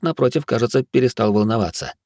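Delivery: usual speaking voice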